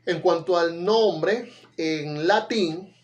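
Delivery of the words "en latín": ordinary talk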